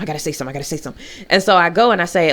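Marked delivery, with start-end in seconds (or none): 0.0-1.0 very fast, much softer repeated mutter; rapid muttering. Retold or imitated. Anxious member rushing to herself before speaking, rapid soft mutter. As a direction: rattle it off under your breath. Anxious self-talk in therapy group.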